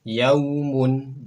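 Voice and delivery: "Yaumun" high and loud, clear au; model Arabic reading. Shown as a demonstration. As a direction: call out "Yaumun" for copying. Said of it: "Berikutnya silakan". (contoh bacaan "Yaumun" lantang)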